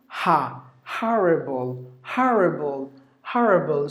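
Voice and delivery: HORRIBLE in American, open "ha-ruh-bul"; American pronunciation. Shown as a demonstration. Teacher showing American horrible, American pronunciation. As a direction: say HORRIBLE slowly the American way, first vowel "ah". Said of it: "If it is American".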